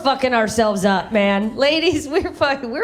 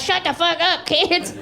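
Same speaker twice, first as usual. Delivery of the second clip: mock screaming mom; 6 semitones higher than usual. Mom screaming at her kids, mock yell. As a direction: mimic a screaming mother without full volume. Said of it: "She'd just be screaming at us".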